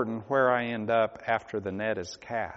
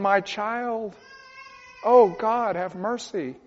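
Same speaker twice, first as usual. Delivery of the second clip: high, pained cries to the Lord; anguished pleading; 7 semitones higher, 4 dB louder than usual. Acted. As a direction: beg God for your own child. Pleads for loved ones, anguished.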